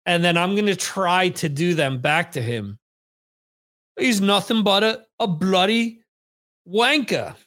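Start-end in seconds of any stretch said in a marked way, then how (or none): 4.0-7.3 put-on British insult; mock British accent, playfully scornful; stupid British accent. Shown as a demonstration. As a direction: do a silly, hammed-up British accent. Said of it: "the stupid British accent for the night".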